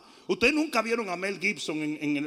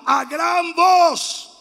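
slow, higher shout; great loud voice; 5 semitones higher, 7 dB louder, much slower than usual. Shown as a demonstration. Preacher shows congregation how Paul spoke, loud drawn-out shout. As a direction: belt it out, drawing it long. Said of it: "¿Cómo lo dijo Pablo?".

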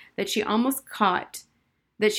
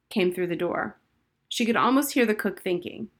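usual speaking voice

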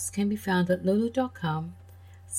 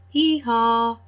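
higher, louder hee-haw; donkey bray; 3 semitones higher, 5 dB louder than usual. Acted. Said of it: "the donkey said".

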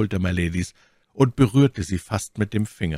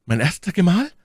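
high, taken-aback question; exclamation; 9 semitones higher than usual. A narrator's reading. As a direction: cry it out, voice lifting in confusion. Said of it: "rief my Lady". (verwirrter, hoher Ausruf)

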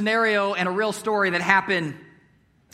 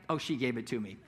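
put-on low, soft, offhand child's fib; fibbing little boy; 9 semitones lower, 7 dB quieter than usual. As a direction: quietly pass off a lie as a kid would. Son's lie, acted.

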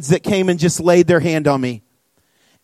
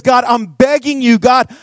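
begging prayer, higher-pitched, then easing down; acted-out desperate plea; crying out to God; 3 semitones higher than usual. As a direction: plead urgently as if praying for your life. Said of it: "He cries out to God".

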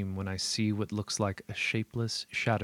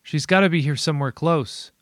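frantic; 6 semitones higher, 8 dB louder than usual. Acted. Frantic search for Lindsay.

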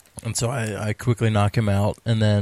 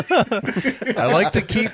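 laughing; 10 semitones higher, slower than usual. Real, not acted. Laughing through a joke.

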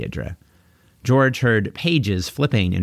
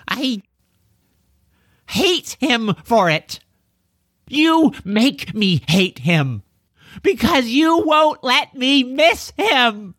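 high, slow, drawn-out outburst; anguished anger. A narrator's reading. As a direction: wring out each word in hurt anger. George's grieving outburst at his parents.

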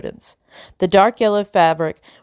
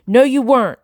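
higher, loud angry shout; 6 semitones higher, 4 dB louder than usual. Acted. Ida shouts at Tumble in anger. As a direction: snap back loudly at Tumble. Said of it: "Ida shouted".

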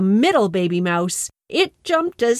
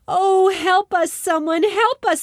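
cried for help; 8 semitones higher than usual. A narrator's reading. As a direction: call out in fear from the kite. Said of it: "they cried and barked and mooed and clucked".

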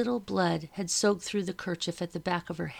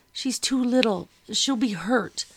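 pleading; 5 semitones higher, 5 dB louder than usual. A narrator's reading. Pleading for sister.

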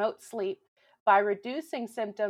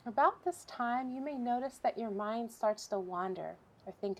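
softer, unhurried relaxation voice with long pauses; guided meditation; 8 dB quieter than usual. Real, not acted. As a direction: guide listeners gently through breathing, leaving room between phrases. Leads a breathing exercise.

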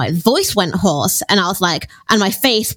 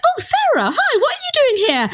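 high-pitched, quick nurse's greeting; surprised triage nurse. Retold or imitated. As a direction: imitate a startled nurse spotting a colleague, pitched way up. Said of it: "the triage nurse was like".

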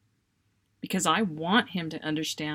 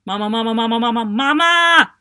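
loud, insistent Mama, Mama, building higher and louder; rapid-fire calling; 6 semitones higher, 13 dB louder than usual. Retold or imitated. Little son nagging his busy mother, loud rapid calls. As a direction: hammer out Mama over and over like a child demanding attention. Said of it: "a machine gun repetition of".

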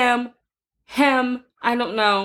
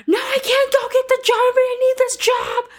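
high-pitched imitation of a frantic woman; mock upset neighbour; 12 semitones higher than usual. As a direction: imitate her panicked plea. Quoting neighbour screaming.

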